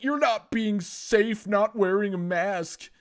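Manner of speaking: high, slow, whiny scolding; mock scolding Karen. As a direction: drag it out like an entitled customer. Karen scolding in his face.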